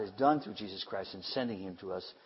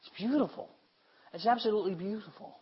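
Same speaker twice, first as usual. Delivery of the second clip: delighted; 9 semitones higher than usual. Real, not acted. Preacher delighted by word meaning.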